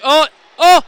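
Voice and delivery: two loud, high shouts of oh; real outburst at the action; excited shouts. Real, not acted. Commentator reacting to the touch, loud high yelps.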